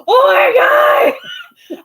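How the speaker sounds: high, very loud fangirl shriek; re-enacted freak-out at full volume; starstruck scream. Retelling freak-out after meeting the star.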